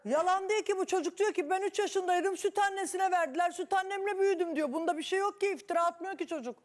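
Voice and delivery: high, insistent pleading; excited defence. Real, not acted. Host defends the boy heatedly.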